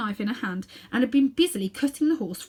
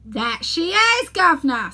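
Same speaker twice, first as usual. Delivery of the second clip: crowd member; 8 semitones higher, 8 dB louder, slower than usual. Acted. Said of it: "said one of the crowd".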